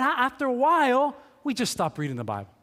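usual speaking voice

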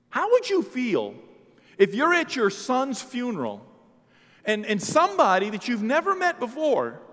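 high, loud impassioned challenge to the congregation; full-force preaching. Real, not acted. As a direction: drive the question home at full volume.

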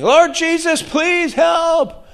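high pleading cry to God; desperate cry out. Retold or imitated. Preacher voicing stadium fans, a high pleading cry. Stadium crowd praying spontaneously.

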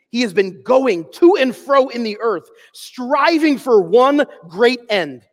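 slow, loud preaching read of the quote. Acted. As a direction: proclaim it slowly, with weight. Reads Ryle's quote in preaching voice.

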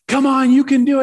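high, loud imitated cheer; egging him on. Dad egging his kid on, imitated loud encouragement. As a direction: urge him on like a pumped-up dad. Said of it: "dad just keeps on egging this kid on".

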